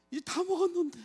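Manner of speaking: high, wailing cry; father at the torn-out feeding tube, wailing; anguished lament. Retold or imitated. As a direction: cry out in despair over the tube. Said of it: "제가 어땠는 줄 아세요?".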